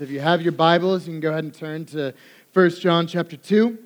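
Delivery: usual speaking voice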